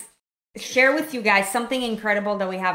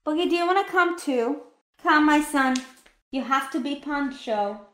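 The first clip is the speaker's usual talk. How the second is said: high, sing-song talk to a pet; talking to a dog; 5 semitones higher than usual. Real, not acted. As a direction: coo the dog along. Owner calling the dog along.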